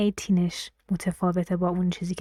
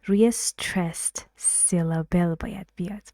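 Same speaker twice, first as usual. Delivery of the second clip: ordinary delivery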